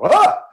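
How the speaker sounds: a sharp, very high and loud scream out of nowhere; sudden dramatic scream. Shown as a demonstration. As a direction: break the calm with a sudden shriek. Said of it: "suddenly get really dramatic".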